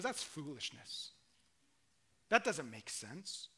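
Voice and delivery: low, slow, flat dismissal; dismissive friend. Retold or imitated. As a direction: brush it off as nonsense, slowly and low. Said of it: "And he goes".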